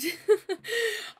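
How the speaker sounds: a short high burst of laughter at getting slaughtered. Real, not acted. Vlogger laughing about losing ranked, high quick laugh.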